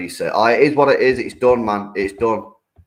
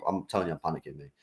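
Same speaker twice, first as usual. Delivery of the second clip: muttered under the breath, much softer; quiet mock panic; 5 semitones lower, 11 dB quieter, faster than usual. Streamer telling chat he will panic, hushed and mock. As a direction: drop almost to nothing, half joking.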